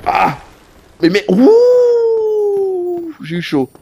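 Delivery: high, very stretched ouh of relief; startled relief. Real, not acted. Nearly dying in the game, a long high relieved ouh. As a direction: squeal out the narrow escape.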